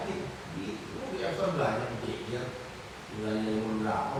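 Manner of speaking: ordinary talk, nothing marked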